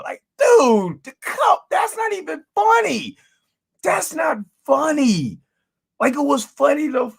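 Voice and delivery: high-pitched, cracking, exasperated cry; can't believe they kept repeating it; exasperated outburst. Real, not acted. Reviewer ranting to viewers about a tired joke, exasperated high cry.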